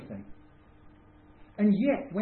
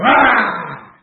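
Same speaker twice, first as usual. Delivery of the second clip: much louder mock outraged yell; angry yell; 4 semitones higher, 17 dB louder than usual. Driver furious at someone who cut him up, yelling. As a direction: bellow it like a driver who's just been cut up.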